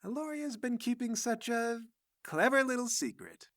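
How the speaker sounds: high, lilting, relishing voice; high-pitched coy fascination; intrigued old madam. Acted. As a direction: savor a naughty secret, pausing midway. Madam delighted by her discovery.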